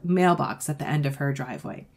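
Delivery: usual speaking voice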